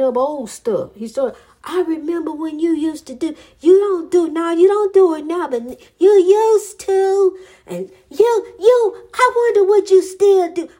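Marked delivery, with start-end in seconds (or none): 1.6-10.7 high, loud whiny mimicry of her ex; mock whining accusation, rising and louder; imitating her ex's nagging in a high, loud whine; imitating Brian whining. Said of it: "that's how he talked".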